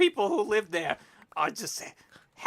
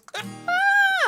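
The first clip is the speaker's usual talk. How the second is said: shrieking laugh; 7 dB louder than usual. Real, not acted. Big laugh at chat message.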